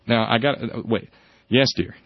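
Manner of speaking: normal talking voice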